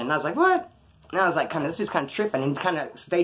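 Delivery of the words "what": high-pitched retold "What?"; incredulous. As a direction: imitate a taken-aback, high yelp. Retold startled reply to challenge.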